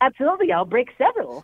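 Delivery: SpongeBob voice. Pretend. Actor signs off in character.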